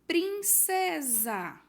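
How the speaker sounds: "Princesa" bem lento, forte e mais agudo, sílaba por sílaba; sílabas separadas. Shown as a demonstration. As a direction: estique cada sílaba de "Princesa". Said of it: "não esqueçam de separar". ("Princesa" silabado, devagar e alto)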